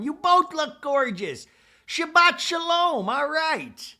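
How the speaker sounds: very high, loud, drawn-out mock holler; Seth. Seth greeting both women, loud high mock holler. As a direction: whoop it out in an exaggerated greeting voice. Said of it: "Seth is there going".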